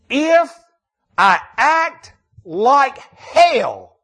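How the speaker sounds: loud, high, slow on If and hell; emphatic. Shown as a demonstration. Pastor quoting David to the congregation, emphatic and slow. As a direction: hammer each word out slowly. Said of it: "I want to say it emphatically".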